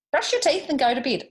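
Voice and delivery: high, loud firm order; George telling off. A narrator's reading. George ordering Bar to brush teeth, high and loud. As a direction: scold the bear up high. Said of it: "George said".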